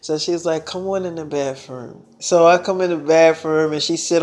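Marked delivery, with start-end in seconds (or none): 0.7-2.0 hushed, low imitation of a girlfriend; quiet invitation. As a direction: drop low and very soft, retold enticement.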